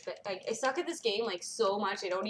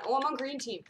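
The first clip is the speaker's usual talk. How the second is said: normal talking voice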